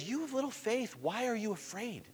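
hushed, very soft question; quiet, unhurried chiding; calm gentle reproof. Acted. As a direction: softly chide frightened friends. Jesus calmly answering the disciples.

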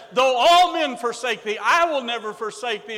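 loud, defiant promise; bold vow. Retold or imitated. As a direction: Peter swearing he will never leave. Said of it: "so boldly said".

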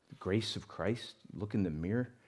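ordinary delivery

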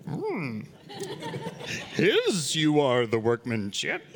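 lightly voiced slow Yoda with a hum; a hint of Yoda, words drawn out; playful slow Yoda impression. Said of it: "if Yoda was speaking it".

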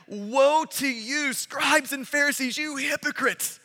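drawn-out, weighty woe to you; slow emphatic woe. Acted. Preacher to the crowd, proclaiming woe slowly. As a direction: lean hard on each woe. Preacher reading Jesus' woes.

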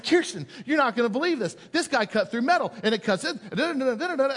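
excited. Retold or imitated. Husband excitedly telling his wife about the knife. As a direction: gush to your wife. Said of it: "and I said".

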